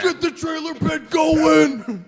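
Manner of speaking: high, loud, drawn-out mock concert yell; rally the crowd at full voice; hype shout. Frontman to the crowd at the trailer pit, slow hyped-up shout.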